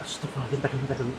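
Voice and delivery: scared hushed prayer; hushed fright. Real, not acted. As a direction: gasp it out quietly in alarm. Startled by a moving snake.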